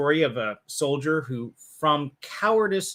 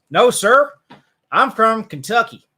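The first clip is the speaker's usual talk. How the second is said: put-on loud, raised quote in a light Kentucky accent; 8 semitones higher, 11 dB louder than usual. A soldier tells his officer he's from Kentucky, light Kentucky accent. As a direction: play a soldier from Kentucky, answering proud and loud. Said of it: "in his accent".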